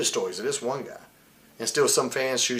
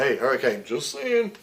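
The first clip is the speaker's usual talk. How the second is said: mocking whine; 4 dB louder than usual. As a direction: parody a nagging colleague. Mocking the boys' whiny remark.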